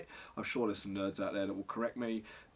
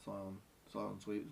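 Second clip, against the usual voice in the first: ordinary delivery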